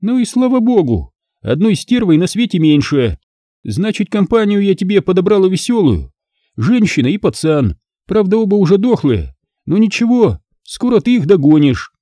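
high, gloating taunts; openly mocking Alisa. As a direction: jeer at him, delighting in it.

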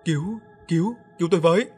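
high loud panicked scream; screaming for help. A narrator's reading. Man torn by dogs, high panicked screaming. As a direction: scream for your life. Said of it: "miệng thì la làng".

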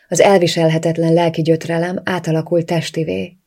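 ordinary delivery

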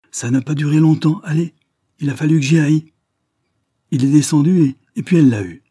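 loud, high, rushing out the tale of the snake; carried away. Acted. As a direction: swept away by his own story, almost shouting. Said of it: "emportée, disant tout à la fois".

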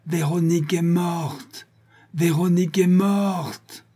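high, slow, howled proclamation. A narrator's reading. Vorski howls twice his victim's death. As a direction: howl it out to the sky. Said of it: "puis hurla deux fois".

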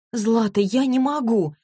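high, slow moan; moaning plea. A narrator's reading. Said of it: "простонала Василиса".

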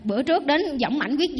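ordinary talk

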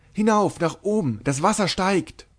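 high frantic cry; panicked shout. A narrator's reading. Another voice in the crowd yells, frantic high cry. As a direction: shriek for everyone to climb. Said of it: "eine andere".

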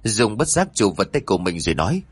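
ordinary delivery, nothing marked

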